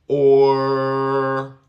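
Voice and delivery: or held long and loud, tongue not touching; drawn-out or. Shown as a demonstration. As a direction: stretch out or with an open mouth. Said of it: "no voy a tocar nada con la lengua".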